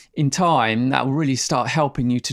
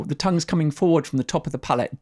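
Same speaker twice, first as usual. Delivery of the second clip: ordinary talk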